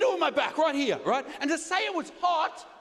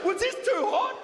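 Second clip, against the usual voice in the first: concerned masseuse; 7 semitones higher than usual. Retold or imitated. Comic imitating the masseuse asking about the rock, higher mock concern. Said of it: "She goes".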